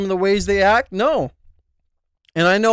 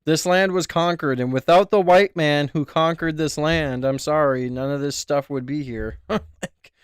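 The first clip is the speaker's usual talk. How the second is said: lower, softer, deadpan mock opinion voice; ironic bigot persona; 7 semitones lower, 4 dB quieter than usual. Playing a smug persona for chat, lower and deadpan. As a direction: play a smug know-it-all straight.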